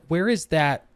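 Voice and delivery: ordinary talk, nothing marked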